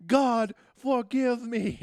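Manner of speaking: high, very loud, slow anguished cry; mock Tommy. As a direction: wail it like a hammed-up Tommy. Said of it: "he's like".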